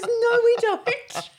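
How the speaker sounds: high, loud, slow no through laughter; laughing contradiction. Retold or imitated. Husband laughing as he contradicts her bluff to the hitchhikers. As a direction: blurt it out while cracking up.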